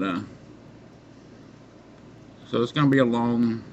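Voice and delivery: usual speaking voice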